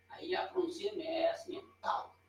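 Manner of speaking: "tal" quase sussurrado, sem voz. Real, not acted. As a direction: solte o "tal" num sussurro de professor. (pronúncia de "tal" em sussurro)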